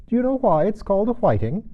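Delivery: high, loud quizzing Gryphon; spring the riddle. Acted.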